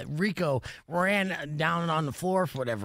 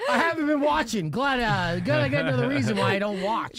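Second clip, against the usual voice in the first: laughing; 7 semitones higher, 6 dB louder than usual. Real, not acted. Laughing at a viewer's jab.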